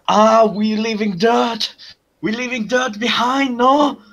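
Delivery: high, excited mock Frenchman; excited French accent. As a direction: play an excited bug creature with a French accent. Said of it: "[name] now excitedly says".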